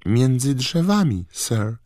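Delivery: high, halting, frightened house-elf; timid elf. A narrator's reading. The elf tells where she found the wand, timid and high. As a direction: stammer it out shyly.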